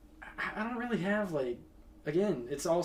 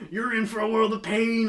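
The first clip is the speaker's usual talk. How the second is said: high, louder strained wrestler taunt; painful Macho Man voice; 7 semitones higher, 8 dB louder than usual. Retold or imitated. Shouting at the mascot during the pep rally in a Macho Man voice. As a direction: squeeze it out like a pained pro wrestler. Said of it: "I'm doing that in a painful voice".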